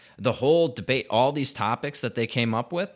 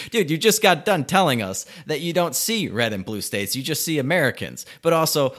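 louder, higher exasperated rant; ranting at Biden's double talk; 6 semitones higher, 5 dB louder than usual. Real, not acted. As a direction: call out the hypocrisy with rising heat.

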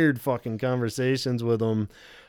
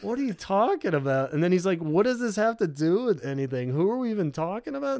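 higher, exasperated Robert voice; imitating Robert; 7 semitones higher than usual. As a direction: keep asking what she means as Robert. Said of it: "only do Robert".

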